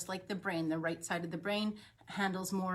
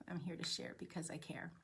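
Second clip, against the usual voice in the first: whispered; 2 semitones lower, 11 dB quieter than usual. Acted. Intimate aside in self-introduction.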